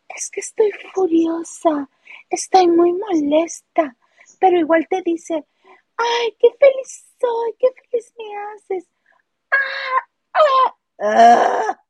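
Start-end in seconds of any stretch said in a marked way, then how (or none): 0.1-3.9 higher, slow, sweet mock of the Brazilian, breathy on furiosa; mocking a sugary voice. Mocking how Miss Brasil says she is furious, sugary and slow. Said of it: "que todo el tiempo te habla así". 6.0-11.8 very high, slow, syrupy mock of the Brazilian; mocking a sugary voice. Mocking Miss Brasil's sweet talk to her housemates, high and syrupy. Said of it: "que todo el tiempo te habla así".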